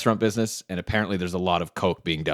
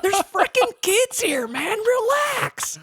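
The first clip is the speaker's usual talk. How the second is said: exasperated friend; slower than usual. Retold or imitated. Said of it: "And Nate's like".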